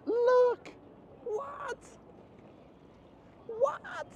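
startled squeak. Real, not acted. Spots something startling on the street.

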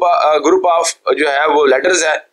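ordinary talk, nothing marked

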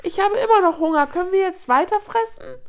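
high-pitched hungry cub voice; high whimpering squeak. A narrator's reading. Hungry hyena cub asking mother for food, high squeak. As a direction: pipe up high, begging mother. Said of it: "ertönte ein hohes Fiepen".

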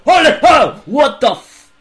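sudden high yelp of shock; jump-scare yell; startled shout. Real, not acted. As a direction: scream in real fright. Jump scare in horror game.

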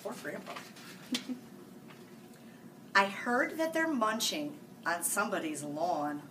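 ordinary delivery

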